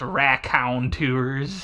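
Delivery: slow Southern drawl on the word; Mississippi Southern accent. Acted. Imagining a Mississippi boy saying the band name, Southern accent. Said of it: "a southern boy from Mississippi".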